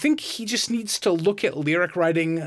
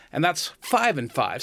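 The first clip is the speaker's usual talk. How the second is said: ordinary talk, nothing marked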